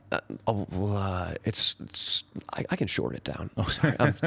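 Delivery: hungover. Real, not acted. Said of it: "I can't tell now if I'm hungover or I'm drunk".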